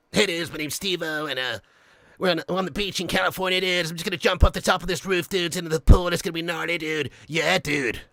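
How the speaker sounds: Californian stunt dude accent; laid-back American dude talk; Steve-O impression. Shown as a demonstration. Stuntman announcing a rooftop pool jump, Californian dude impression. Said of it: "You're Steve-O's class too".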